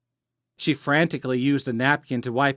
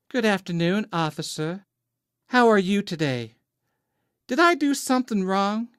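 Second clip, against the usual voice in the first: high-pitched, innocent Southern belle; affectionate Southern accent; 8 semitones higher than usual. A narrator's reading. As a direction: play up a sweet Southern belle, rising on the questions. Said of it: "slightly affectionate Southern accent".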